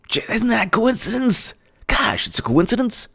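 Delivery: high, soft mock wonder; sarcastic. Real, not acted. Host mocking the coincidence, soft and high sarcasm. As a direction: feign innocent surprise. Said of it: "sarcastic mock wonder".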